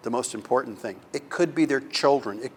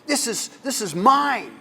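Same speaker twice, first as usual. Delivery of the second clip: higher, louder possessive outburst; flustered and mad; 9 semitones higher, 4 dB louder than usual. Retold or imitated. Bilbo defending his ring against Gandalf, flustered and mad. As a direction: snap back like a man clinging to his treasure. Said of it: "he's all flustered and he's all mad".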